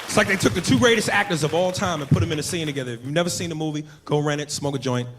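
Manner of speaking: ordinary talk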